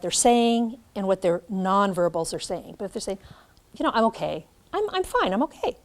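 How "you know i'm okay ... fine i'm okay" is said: brightly insisting you're fine, up high on fine; a cheery reassurance that goes too far; upbeat okay. Shown as a demonstration. Teacher demos a too cheerful I'm okay for the class. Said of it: "I just went the other way again".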